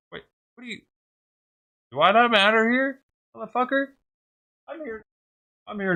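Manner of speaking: high, squeaky retold outrage at the kids; indignant outburst. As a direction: act out the offence of being ignored.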